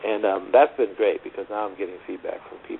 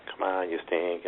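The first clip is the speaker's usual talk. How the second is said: normal talking voice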